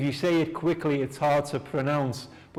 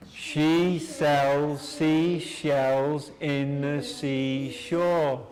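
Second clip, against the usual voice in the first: very slow, drawn-out model line; slowly; much slower than usual. Shown as a demonstration. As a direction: stretch every word so they can follow. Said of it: "we will do slowly".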